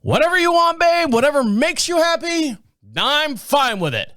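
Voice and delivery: a husband with his back turned waving off his wife, mock dismissive. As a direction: toss it off over your shoulder. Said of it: "He's like".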